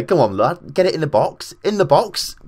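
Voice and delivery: loud, rising shouts at the players; excited urging. Real, not acted. Gamer willing his team to score, excited urging.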